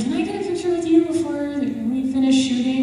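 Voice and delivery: high, sing-out imitation of a starstruck friend; cute pleading. Friend asking the actor for a picture, cute pleading. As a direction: mimic a cute, hopeful request up high. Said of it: "But she was so cute".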